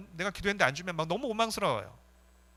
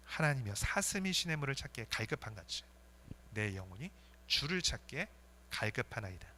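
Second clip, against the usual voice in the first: reverent psalm reading; 4 semitones lower, 6 dB quieter than usual. Acted.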